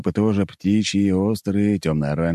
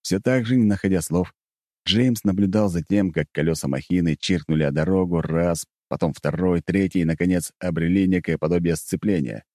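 plain reading voice

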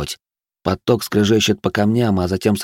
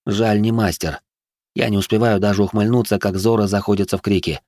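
usual speaking voice

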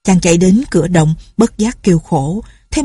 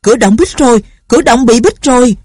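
raised, higher-pitched cry of alarm; panicked cry; 5 semitones higher, 4 dB louder than usual. A narrator's reading. Trapped crowd alerting one another at the cave mouth, alarmed cry. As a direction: narrator voicing a crowd's mild panic. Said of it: "mọi người kinh hoàng la lên".

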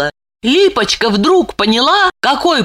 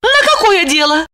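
high puzzled question; surprised; 10 semitones higher than usual. A narrator's reading. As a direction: ask in surprise. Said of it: "Липочка удивилась".

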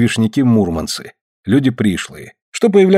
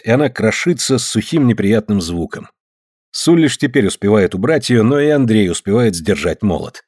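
ordinary delivery

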